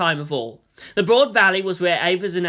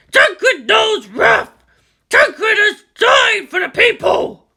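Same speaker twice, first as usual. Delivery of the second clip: booming war machine shouting high and slow; dreadnought battle cry; 14 semitones higher, 9 dB louder, slower than usual. Acted. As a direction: roar it like an ancient war machine. Dreadnought Tancred battle cries.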